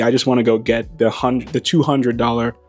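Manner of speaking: ordinary delivery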